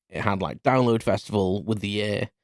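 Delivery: ordinary talk, nothing marked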